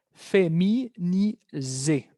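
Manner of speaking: Feminiser said slowly in French, fe-mi-ni-ZÉ. Shown as a demonstration. Teacher models feminiser for Spanish-speaking students in French. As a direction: sound out Feminiser the French way, slow. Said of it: "Observan la pequeña diferencia".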